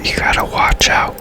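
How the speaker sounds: ordinary talk, nothing marked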